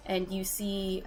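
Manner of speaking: ordinary delivery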